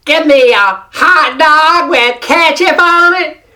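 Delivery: loud, high, drawn-out American twang; mock an American with a strong nasal twang. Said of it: "strong nasal twang".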